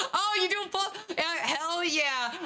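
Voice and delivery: high laughing reply; laughing delight. Real, not acted. DM laughs at player's pull-up.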